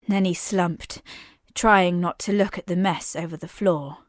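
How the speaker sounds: plain reading voice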